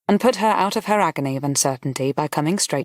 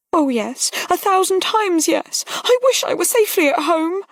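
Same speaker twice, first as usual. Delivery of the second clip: put-on fervent young woman; 13 semitones higher than usual. A narrator's reading. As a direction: pour it out, wishing for home. Voices Harriet, fervent.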